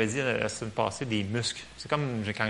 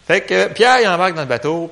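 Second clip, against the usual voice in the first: burst of preaching energy; 6 semitones higher, 11 dB louder than usual. Real, not acted. Preacher launching the story.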